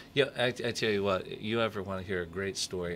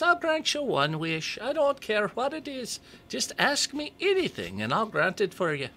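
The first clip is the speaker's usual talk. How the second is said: leprechaun voice; 13 semitones higher than usual. Acted. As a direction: pitch way up into a comic leprechaun. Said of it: "great leprechaun voice".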